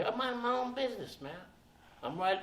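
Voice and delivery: ordinary talk, nothing marked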